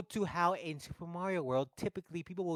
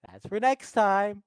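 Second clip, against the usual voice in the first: slow teasing tease; 6 semitones higher, 6 dB louder, slower than usual. Retold or imitated. Bowsette teasing.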